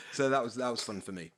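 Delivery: ordinary talk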